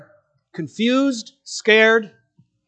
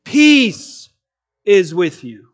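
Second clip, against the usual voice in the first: declaration of peace; 2 semitones lower, 4 dB louder, much slower than usual. Retold or imitated. Preacher proclaiming Jesus' declaration to the disciples, strong and ringing. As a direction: proclaim it like a victorious king. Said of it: "he is making a declaration".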